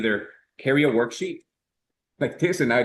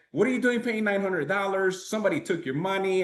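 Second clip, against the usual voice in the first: higher pitched, mock dismissive friend; scoffing friend Jose; 8 semitones higher than usual. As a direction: play the know-it-all buddy brushing it off.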